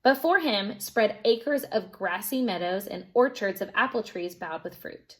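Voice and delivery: ordinary delivery, nothing marked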